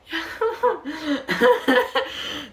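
high burst of laughter. Real, not acted. Vlogger finds the mean comment funny, laughing high. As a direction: crack up in a high-pitched laugh.

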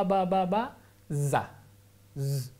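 "ba, ba, ba" then a voiced buzzing "za", then "Z" alone; steady "ba" beat, then a foreign-sounding "za"; syllable demo. Shown as a demonstration. Explaining a sound foreign to Argentine Spanish, syllables spoken one by one. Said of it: "haces ba".

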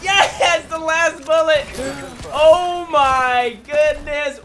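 high, loud triumph, stretching out at the end; thrilled shouting. Real, not acted. Gamer winning on last bullet, thrilled shout. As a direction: shout it in disbelieving joy.

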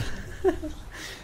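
airy high giggle; breathy laugh. Real, not acted. Gamer laughing at his friends, airy giggle.

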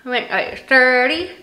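strips covering the teeth; slightly careful mouth movements; speaking with whitening strips on. Real, not acted. Seen 0: strips pressed on teeth at -12 to -4, mouth still coated.

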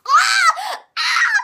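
laughing shriek of disgust; shriek, half laughing, half revolted; disgusted scream. Real, not acted. Tasted rotten egg bean.

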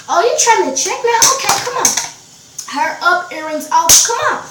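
loud, high acted squaring-up; fighting challenge. She squares up to the boy, fighting challenge.

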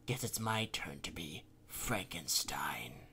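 low, slow menacing whisper. Acted. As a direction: breathe it out like a threat. Ryutaro menacing the engineer.

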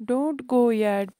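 a loud pleading call from the prince. A narrator's reading. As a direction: call out, begging him to stay. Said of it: "pleaded the prince".

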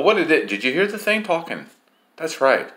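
ordinary talk

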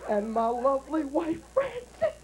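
slow, drawn-out sung confession; very slow mock-weeping confession; Swaggart's tearful confession. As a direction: wail out the apology like a tearful televangelist. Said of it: "this is the best part".

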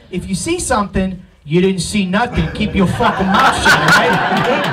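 put-on a lightly done low Brooklyn tough-guy accent; a hint of Brooklyn street warning, low. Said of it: "That is a real accent where I'm from".

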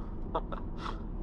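quiet unvoiced chuckle; airy little laugh; soft breathy laugh. Real, not acted. Laughing after acceleration.

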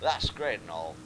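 low, hesitant try at Australian; half-hearted Australian accent; attempted Australian accent. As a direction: have a go at Australian. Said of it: "I'll go Australian".

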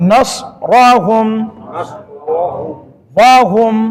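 each word drawn out very slowly, one by one; slow word-by-word recitation. Shown as a demonstration. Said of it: "যেমন এটা একটা শব্দ". (ধীরে ধীরে শব্দ শব্দ করে তিলাওয়াত)

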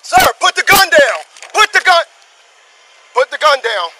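sharp commanding yell at a gunman; real shouted command. Real, not acted. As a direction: bark the order to drop the gun. Officer confronting armed man.

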